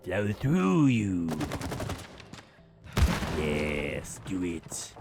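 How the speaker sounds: slow, drawn-out dark lord; Sith Lord voice. Pretend. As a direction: draw it out like a Sith. Sith Lord line, slowed.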